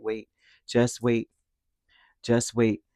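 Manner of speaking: ordinary talk, nothing marked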